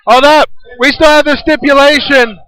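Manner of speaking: high, loud urgent call; excited play-by-play. Acted. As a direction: remind them of the stipulation.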